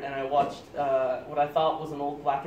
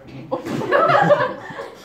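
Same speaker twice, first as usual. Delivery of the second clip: very high loud laugh burst; 9 dB louder than usual. Real, not acted. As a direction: shriek with laughter. Laughs at popcorn off floor.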